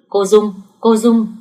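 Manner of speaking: calling out. A narrator's reading. Healer hails the search party, calling out. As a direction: call out to someone down the path. Healer calls out to approaching group.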